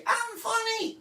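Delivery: put-on squeaky old-me I'm funny. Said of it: "I just used to be".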